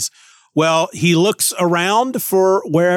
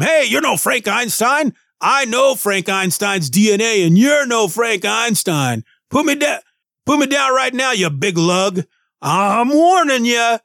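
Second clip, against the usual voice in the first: a tiny robot squaring up to a giant; Ben Grimm; 7 semitones higher than usual. Acted. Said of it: "associate that with Ben Grimm".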